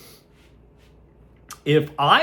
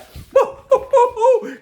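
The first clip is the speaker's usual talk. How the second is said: loud burst of laughter; 7 dB louder than usual. Real, not acted. Bursts out laughing after insult.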